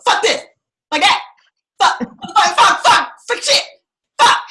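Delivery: high, loud string of curses; mock cussing rant. Retelling her journal, a loud high cursing rant. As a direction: rattle off the curses like venting on the page.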